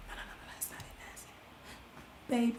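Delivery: whispered inner critic; self-critique whisper. Shown as a demonstration. Correcting her own apology in the mirror, whispered. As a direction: hiss a correction to yourself. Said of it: "practicing how I'ma say sorry".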